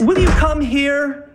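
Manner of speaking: high, slow, drawn-out order; exasperated demand. Acted. Husband calling wife back to argue, exasperated. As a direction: stretch each word in exasperation.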